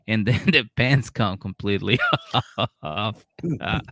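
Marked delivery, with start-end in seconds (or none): none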